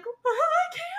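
very high, squeaky mock wail; overwhelmed squeal. Viewer recalling her reaction to the reading, high overwhelmed squeal. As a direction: squeal it as if overcome.

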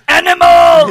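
loud, high Muppet yell; Muppet shout. Pretend. Naming the Muppet Animal, a loud puppet bellow. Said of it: "Yeah, Fozzie Bear".

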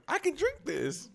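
high, slow quoted reaction; mock delighted approval. Host mimicking own reaction to each drink, mock delight. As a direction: draw it out high, half laughing.

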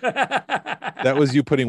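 slow words through laughter; laughing. Real, not acted. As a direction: laugh while talking. Laughing after stoner bit.

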